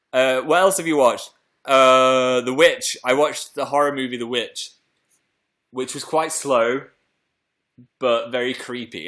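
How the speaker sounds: usual speaking voice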